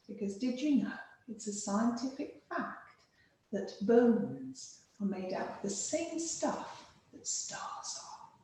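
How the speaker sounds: normal talking voice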